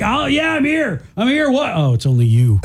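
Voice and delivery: high eager dog voice dropping in letdown; eager and high, then deflate on the last bit; dog's inner voice. Retold or imitated. Host voicing a dog who hopes for a mate, then let down. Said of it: "So they're like".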